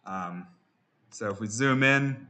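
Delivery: ordinary talk, nothing marked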